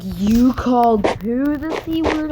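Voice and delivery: high, loud, drawn-out accusation; mock outrage. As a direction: imitate an appalled teacher confronting a student. Retold teacher confronting the student.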